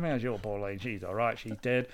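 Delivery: quoted line dropped low and soft; soft, low mock-character delivery of a Boosh line. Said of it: "which I say to Noelle all the time".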